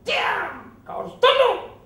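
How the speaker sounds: high, loud barked order; furious shout. Retold or imitated. Preacher shouting down his own anger, loud high shout. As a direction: shout yourself into silence. Commanding himself not to rage.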